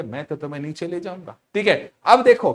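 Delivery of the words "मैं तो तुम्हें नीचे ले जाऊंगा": lightly voiced, much softer aside; voice of weight. Teacher plays weight pulling things down, soft hushed voice. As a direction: speak quietly as a personified force. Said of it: "भार कहेगा कि".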